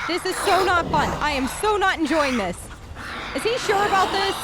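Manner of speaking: panicking as the fight turns ugly; frantic. Real, not acted. Player overwhelmed by spirits, flustered and loud.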